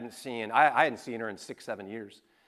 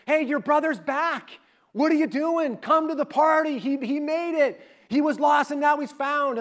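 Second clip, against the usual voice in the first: much higher, eager coaxing; a dad pleading with his son to come in; lightly acted excited plea; urging father; 12 semitones higher, 5 dB louder than usual. Father urges older son to party.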